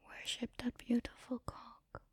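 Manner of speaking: usual speaking voice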